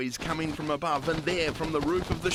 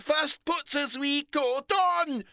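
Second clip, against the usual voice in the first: put-on higher, louder Scottish old lady voice; 5 dB louder than usual. A narrator's reading. Old Scottish lady warning the two girls, Scottish accent. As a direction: advise them kindly in a Scots lilt. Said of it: "the little old Scottish lady advised".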